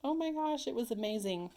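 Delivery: usual speaking voice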